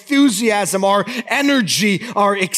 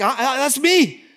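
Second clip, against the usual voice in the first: mock confession of anger; 9 semitones higher, faster than usual. Looking at your heart and like.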